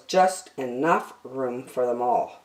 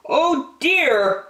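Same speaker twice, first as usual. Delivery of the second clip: high, kindly park keeper; a gentle, fussy park keeper; Percy's voice; 9 semitones higher than usual. A narrator's reading. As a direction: sympathetic old man, pitched up. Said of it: "said Percy".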